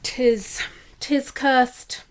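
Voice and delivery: loud, high spooky proclamation; mock ominous. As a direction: declare the curse like a hammy ghost. Echoing mock curse.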